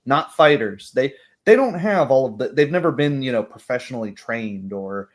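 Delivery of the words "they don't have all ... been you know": ordinary delivery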